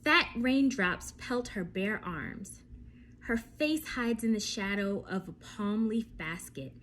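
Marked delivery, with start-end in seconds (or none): none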